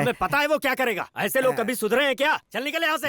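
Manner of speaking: high-pitched indignant scolding; angry rejection. Acted. Refusing the matchmaker and ordering him out, angry. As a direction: rail at him and throw him out, pitch climbing.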